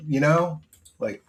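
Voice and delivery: ordinary talk, nothing marked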